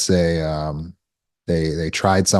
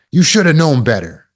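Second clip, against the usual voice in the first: high, loud scolding parent voice; scolding a child; 8 semitones higher, 6 dB louder than usual. Retold or imitated. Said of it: "Think about looking at your child".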